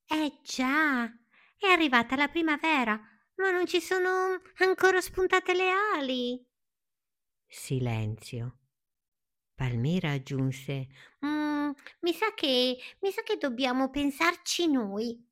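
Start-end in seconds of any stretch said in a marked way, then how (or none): 0.1-6.5 high-pitched, lively little caterpillar voice; exclaiming lively caterpillar. A narrator's reading. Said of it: "Palmira esclamò". 11.3-15.3 high, pondering little caterpillar voice; lively caterpillar. A narrator's reading. Lively Palmira adds an idea.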